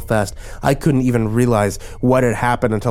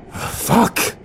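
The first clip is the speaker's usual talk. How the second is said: frustrated outburst, pitched up; yelled curse; 11 semitones higher than usual. A narrator's reading. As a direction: curse in exasperation, without full volume. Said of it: "I yelled".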